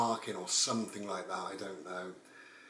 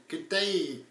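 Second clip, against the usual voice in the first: higher-pitched mock Australian greeting; bad Australian accent; 7 semitones higher, 3 dB louder than usual. Narrator retrying the traveller's greeting in a badly imitated Australian accent. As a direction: have another failed go at an Australian accent. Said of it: "I can't do Australian".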